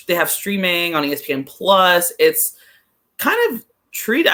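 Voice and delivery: ordinary delivery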